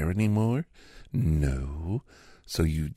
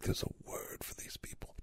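whispered aside; 14 dB quieter than usual. Acted. Aside about science deniers.

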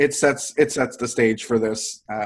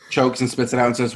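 usual speaking voice

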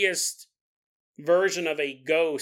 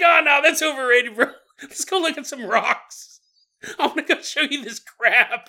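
chummy ghost; 8 semitones higher, 6 dB louder than usual. Retold or imitated. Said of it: "It's like".

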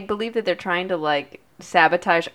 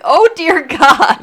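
shocked; 10 semitones higher, 10 dB louder, slower than usual. Retold or imitated. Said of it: "I was like".

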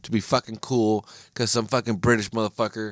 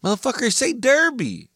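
high-pitched exasperated demand; exasperated outburst; 3 dB louder than usual. Real, not acted. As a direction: blurt it out, fed up. Exasperated rant at the pronunciation.

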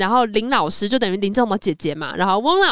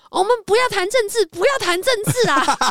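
much higher, put-on nervous principal voice; fussy principal; 10 semitones higher than usual. Principal walking in on the teachers' row, higher flustered voice. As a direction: play a flustered old principal dodging trouble. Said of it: "他说".

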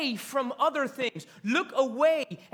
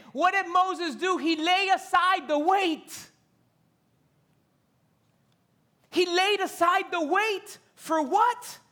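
higher, louder preaching build; rising preaching; 8 semitones higher, 5 dB louder than usual. Real, not acted. Preacher pressing the congregation on Moses, rising fervor. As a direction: drive the question home.

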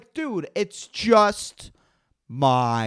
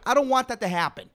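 ordinary talk

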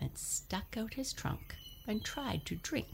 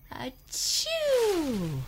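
a loud, high trumpeting achoo; big sneeze; 13 semitones higher, 9 dB louder than usual. Acted. Narrator sneezing for Elephant, loud high achoo. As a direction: let the sneeze explode out.

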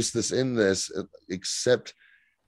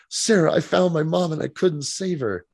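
mock dramatic Zari; 7 semitones higher than usual. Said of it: "so she goes".

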